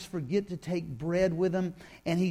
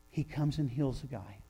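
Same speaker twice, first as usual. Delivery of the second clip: normal talking voice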